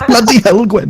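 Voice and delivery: high put-on Welsh; quick jokey Welsh catchphrase; exaggerated Welsh catchphrase; mock Welsh accent. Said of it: "do the Welsh".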